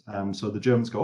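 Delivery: usual speaking voice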